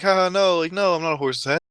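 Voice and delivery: high child voice with a put-on chuckle; Billy. Boy answering the clown, innocently amused. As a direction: imitate a kid laughing off a silly question. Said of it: "He's like".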